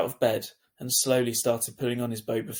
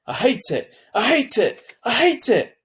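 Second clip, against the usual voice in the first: building shriek, much higher and louder each time; 12 semitones higher, 9 dB louder than usual. A narrator's reading. Furious boy shrieking about school at top of lungs. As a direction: scream it, growing with every repeat. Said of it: "He was shrieking at the top of his lungs".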